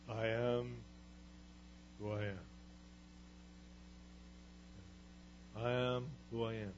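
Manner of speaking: lightly voiced grave Charlton Heston God; great movie God voice. Preacher imitating the movie God's answer, lightly grave. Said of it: "In that great voice".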